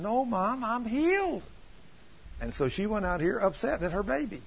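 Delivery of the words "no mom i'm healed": put-on high little-boy voice; acted boy's high, certain refusal; imitated insistent child. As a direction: pitch it up as a child protesting to Mom. Boy refuses his mother.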